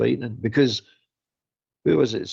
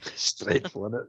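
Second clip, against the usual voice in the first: usual speaking voice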